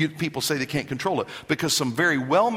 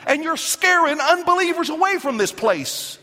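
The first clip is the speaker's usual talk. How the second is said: higher, louder, slow rebuke; stern rebuke; 9 semitones higher, 4 dB louder, slower than usual. Retold or imitated. Paul rebuking the Corinth church, loud and stern. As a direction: scold them like the apostle. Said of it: "And he says".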